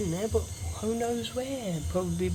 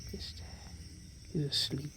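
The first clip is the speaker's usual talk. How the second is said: whispering; 3 semitones lower, 12 dB quieter, slower than usual. Real, not acted. Quietly pointing at sleeping snake.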